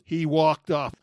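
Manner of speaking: normal talking voice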